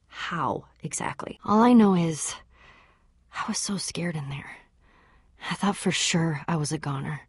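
shaken hushed confession; frightened whisper. Acted. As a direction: whisper the fear as if still inside. Recalling being trapped.

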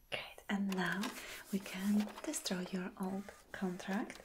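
ordinary delivery

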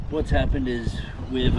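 ordinary talk, nothing marked

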